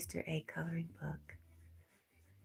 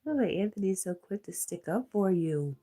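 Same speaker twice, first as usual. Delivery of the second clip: normal talking voice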